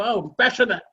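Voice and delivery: lightly hammed-up passionate gushing; mock passionate. Speaker mimicking dreamers who talk of passion, mock passionate. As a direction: imitate someone gushing with passion. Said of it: "बड़े passionate बोलते हो".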